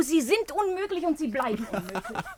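chiding him through laughter; amused, teasing exasperation; laughing reproach. Acted. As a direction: scold him while laughing.

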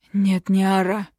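lightly croaked warning; hoarse protest. A narrator's reading. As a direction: wheeze out a refusal while struggling up. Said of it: "прохрипел отец".

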